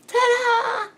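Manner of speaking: high, loud, held ta-da; triumphant fanfare. Real, not acted. Showing off the result.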